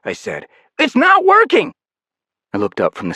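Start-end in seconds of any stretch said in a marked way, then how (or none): 0.8-1.7 very high, loud panicked cry; panicked shout. Acted. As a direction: yell in alarm. Panic over failing device.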